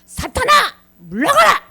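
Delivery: high, loud commanding shout; explosive acted reprimand; stern scolding. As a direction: rebuke sharply and drive them away. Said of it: "야단을 탁 치시면서".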